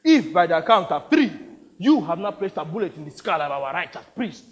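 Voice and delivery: theatrical threat with loud bursts; menacing warlord. Acted. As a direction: set out a deadly game with relish. Plays the warlord.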